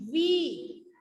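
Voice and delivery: "वी" with the ee held long; long ee vowel. Shown as a demonstration. Teacher explaining the student's mistake, long-drawn "वी". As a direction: stretch out the vowel of "वी". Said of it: "लंबा हो जाता है".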